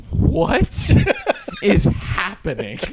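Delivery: high squeaked "what", then drawn out; mock bafflement, stretching the last words; bewildered disbelief. Recruit hearing strange noises at night, baffled mock disbelief.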